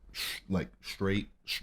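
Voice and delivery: ordinary delivery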